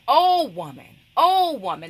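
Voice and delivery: loud, stretched OH before each WOMAN; forceful repeated exclamation on OH; emphasis on OH. Shown as a demonstration. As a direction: punch and lengthen each OH, falling after. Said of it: "That's emphasis".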